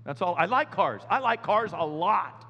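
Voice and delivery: slow, drawn-out whine; whiny plea. Retold or imitated. Pastor whining to God about selling cars, slow. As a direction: whine it out slowly. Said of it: "it's like".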